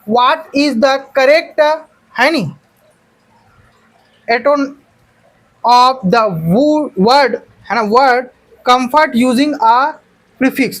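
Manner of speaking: very slow, word by word dictation; slow classroom read-aloud of the question. Shown as a demonstration. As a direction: read the question out slowly for students to write. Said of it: "teacher dictating the question slowly".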